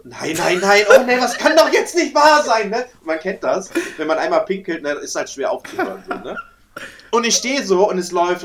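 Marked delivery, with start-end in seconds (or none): 0.0-2.7 panicked. Retold or imitated. Caught peeing, panicked own reaction.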